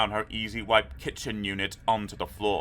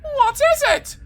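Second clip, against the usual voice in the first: high, loud alarmed shout; a mother shouting in panic; 10 dB louder than usual. A narrator's reading. Helen's mother shouting to the father, high and loud. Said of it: "shouted Helen's mother".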